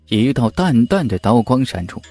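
ordinary delivery, nothing marked

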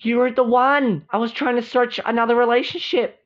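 high, quickening, thrilled voice; excited realization. Acted. Serena realizes he is the one, excited gush. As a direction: gush at finding the right one.

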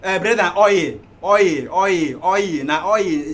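loud, insistent repetition; tegas mengoreksi. Acted. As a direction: hammer the word home at full volume. Insists on oil, correcting him.

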